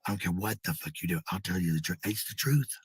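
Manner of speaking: ordinary delivery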